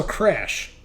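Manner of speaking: normal talking voice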